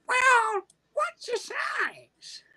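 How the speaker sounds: high, very slow questioning voice; prim older lady. Retold or imitated. Older lady asking the boy his size, slow and high. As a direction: ask it sweetly and drawn out.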